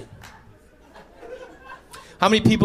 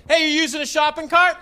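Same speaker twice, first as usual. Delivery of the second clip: chirpy call; 11 semitones higher than usual. Acted. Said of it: "I go".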